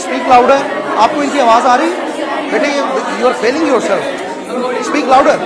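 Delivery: loud, insistent call to the speaker; loud coaching call. Real, not acted. Teacher urging student at the front, loud calls. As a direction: call over the room to make her project. Coach shouting instructions mid-speech.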